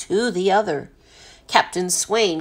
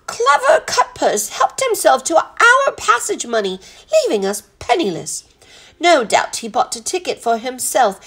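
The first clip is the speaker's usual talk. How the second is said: high, formal, elaborately polite explanation; dignified butler's lilting speech; composed butler; 6 semitones higher than usual. Acted. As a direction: plead the case with courtly precision.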